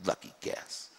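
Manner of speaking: unvoiced, muttered reply; muttered retort. Angry man retorting to the preacher, muttered. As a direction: mutter it back under the breath. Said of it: "He goes".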